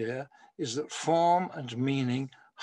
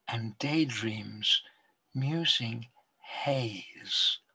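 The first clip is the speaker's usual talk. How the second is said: slow, measured poetry recital with long pauses; poem reading. Acted. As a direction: read it like a poet savouring each line.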